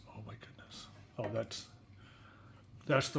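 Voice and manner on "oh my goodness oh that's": breathy whispered oh my goodness; whispered dismay. Real, not acted. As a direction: whisper it in soft shock. Reacting to ruined comic books.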